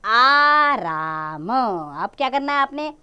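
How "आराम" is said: आराम with both आ drawn long; slow demo word. Shown as a demonstration. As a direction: stretch आराम for the class. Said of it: "बन गया".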